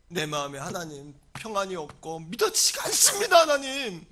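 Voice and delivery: drop, then swell into a loud plea; anguished plea. Acted. Missionary parent admitting lost faith to God, anguished plea. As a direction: confess despair, ending in a cry. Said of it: "선교사님이 그렇게 절규했을 것 같아서".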